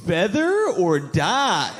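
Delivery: slow, playful teasing question; mock flirty. Stranger quizzing a half-Indian man, mock flirty. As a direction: draw it out as a coy little quiz. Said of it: "What a fun, flirty way".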